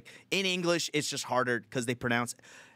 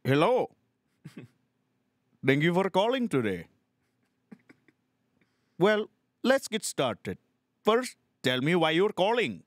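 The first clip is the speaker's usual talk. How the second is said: higher-pitched mock Indian accent; Indian phone agent; 5 semitones higher, 3 dB louder than usual. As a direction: greet a caller in an exaggerated Indian accent. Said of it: "Just an Indian guy calling you".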